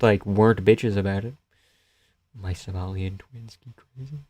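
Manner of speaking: ordinary talk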